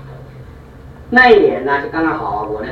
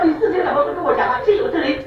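higher, laughing indignation; hammed-up offended wife, pitched up; wife rebuking her husband, higher and laughing; mock indignant wife; 10 semitones higher than usual. Wife's indignant retort.

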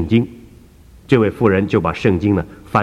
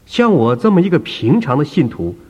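put-on higher, insistent elderly believer; a raised, fretful old lady's voice; earnest old woman; 4 semitones higher than usual. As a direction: plead your case as the humble churchgoer. Woman answers doctor.

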